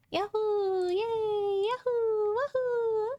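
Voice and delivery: strained high celebratory whoops; high cheer from the throat. Pretend. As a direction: cheer like a tiny excited mascot. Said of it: "I wasn't speaking from my stomach, and I was using my throat".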